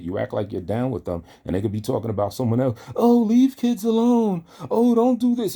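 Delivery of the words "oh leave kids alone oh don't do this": very high, loud, slow prissy mock scolding. Mocking the viewer's fake outrage in the chat room, shrill and slow. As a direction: screech it like a prim goody-goody.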